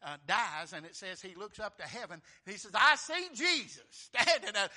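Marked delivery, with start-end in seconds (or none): 2.7-4.7 loud, slow, awed cry; Stephen's vision. Retold or imitated. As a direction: proclaim it slowly with awe. Voicing dying Stephen.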